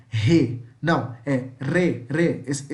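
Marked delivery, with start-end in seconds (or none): 0.1-0.6 "re" with a breathy h instead of a trill; wrong-way "re", said as he. Shown as a demonstration. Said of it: "nós não vamos falar". 1.6-2.4 "re" with a rolled tongue-tip R; slow trilled "re"; vibrating R. Shown as a demonstration. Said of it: "esse vibrando, sabe?".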